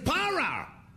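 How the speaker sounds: high yelled Para; high mock shout of Para; shouted stop. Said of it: "tiene que decir".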